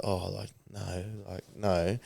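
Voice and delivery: mock-timid refusal, soft and hesitant; imitating a shy mate, soft and slowed; shy mumble. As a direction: mumble it like a shy kid turning girls down. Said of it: "was a bit shy".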